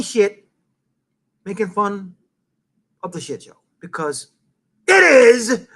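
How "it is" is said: loud, drawn-out holler; throat-straining yell. Real, not acted. Host hammering home why he mocks the shit show, throat-straining yell. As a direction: belt it out slowly, higher and throat-hurting. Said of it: "I hurt my throat saying it like that".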